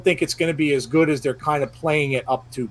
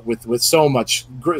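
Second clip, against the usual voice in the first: usual speaking voice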